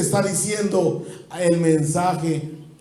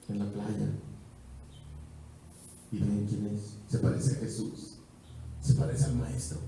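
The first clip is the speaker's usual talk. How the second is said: soft, lower storytelling voice; hushed Bible storytelling; 6 semitones lower, 7 dB quieter than usual. A narrator's reading. Pastor retelling Peter's story to the church, hushed. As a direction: draw them in quietly.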